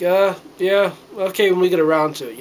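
lightly voiced, dismissive Brit; mock British brush-off. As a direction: play the British putting them off lazily. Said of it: "The British were like".

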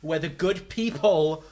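slow, loud, high wail of outrage; outraged rant. Real, not acted. Streamer raging at friends about Space Jam, slow loud wail. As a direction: drag it out in mock despair.

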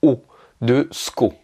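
sko stressed and drawn out slowly. Shown as a demonstration. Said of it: "l'accentuation, elle est sur le O de sko".

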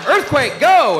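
high, loud shouted warning; panicked yell. Retold or imitated. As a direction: scream the warning to run. Yelling to flee the earthquake.

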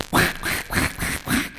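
breathy mocking quacks under the breath; whispered quacking. Donkey whispers the duck's quacking. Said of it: "the duck is always going".

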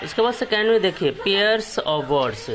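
ordinary talk

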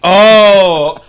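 ordinary delivery

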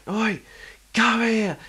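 high loud yell; shouting drunk. Retold or imitated. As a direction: holler at him to come over. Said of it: "And he's like".

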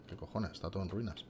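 soft, low muttered swearing; cursing under breath. Real, not acted. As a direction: mutter it to yourself. Swears quietly at the ruins.

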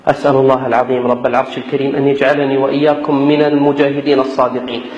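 normal talking voice

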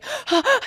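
high sharp gasp of thrill; excited gasp. Retold or imitated. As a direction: gasp with delight. Excitement after Kimmel show.

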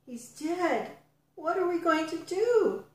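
high-pitched dismay; storyteller voicing upset mourners; distraught. A narrator's reading. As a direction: cry out in helpless grief, acted. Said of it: "Jesus' friends and disciples wept".

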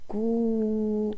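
كو with a held long oo; long vowel demo. Shown as a demonstration. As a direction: stretch the oo in كو. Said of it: "إنه".

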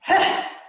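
big noisy sigh out; loud volcano breath out; loud tantrum exhale. Shown as a demonstration. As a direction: blow it out like a volcano. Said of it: "And again".

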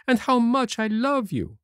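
put-on boyish Pinocchio voice. A narrator's reading. To the fairy, Pinocchio speaks in a high boyish voice.

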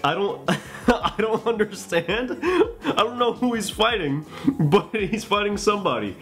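high, slow, giggly bewilderment; amused and lost; laughing. Real, not acted. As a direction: laugh helplessly through the words. Giggling at own confusion.

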